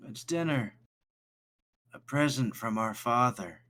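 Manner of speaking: put-on eerie high child voice. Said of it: "you hear this child say".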